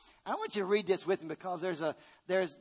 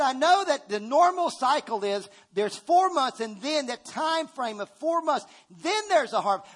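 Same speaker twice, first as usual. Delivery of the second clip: voicing God higher and louder, declaring; God speaking; 5 semitones higher, 5 dB louder than usual. Preacher voicing God's promise to the church, raised and emphatic. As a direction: proclaim it as God's own words, lifted high. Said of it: "He said".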